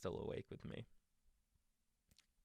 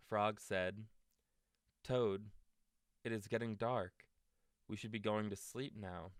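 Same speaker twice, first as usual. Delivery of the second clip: gentle storybook read-aloud; reading to children; 3 semitones higher, 6 dB louder than usual. A narrator's reading. Parent reading a bedtime story to a child, gentle.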